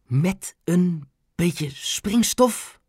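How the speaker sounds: halting, very slow disbelief; stammering. A narrator's reading. Grandma hears of explosives, stammering. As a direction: stammer it word by word, aghast. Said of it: "stamelde oma".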